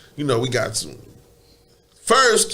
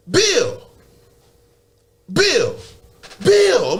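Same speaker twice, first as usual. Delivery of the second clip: calling out; 4 semitones higher, much slower than usual. Retold or imitated. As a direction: call his name like he's rooms away. Calling out for his friend.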